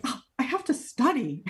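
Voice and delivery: put-upon student's complaint, hammed up; bratty. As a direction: imitate your whiny younger self begging off chores. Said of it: "so bratty about it".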